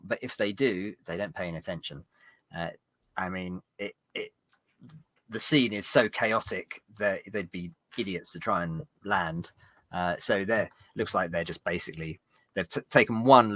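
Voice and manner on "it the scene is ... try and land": normal talking voice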